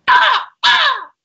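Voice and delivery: high, loud bird caw; crow squawk. Shown as a demonstration. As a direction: screech out a crow call. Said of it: "let me see if I can do it".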